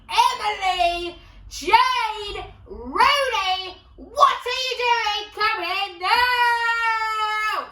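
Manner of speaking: history teacher yelling at a pupil, hammed-up shout; teacher's yell. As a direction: bellow the names across the classroom. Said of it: "this man being like".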